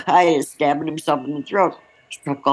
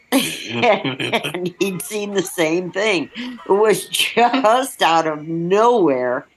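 laughing; 5 semitones higher, much slower than usual. Real, not acted. Laughing while recalling the gesture.

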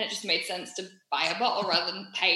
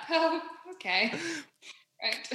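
nervous laugh; 6 semitones higher, 3 dB quieter, slower than usual. Retold or imitated. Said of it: "we just laughed awkwardly".

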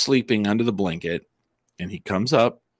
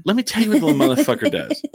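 fast and higher, laughing through it; laughing rant; 11 semitones higher, much faster than usual. Real, not acted. Telling friends about his cat, laughing fast. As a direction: rattle it off while cracking up.

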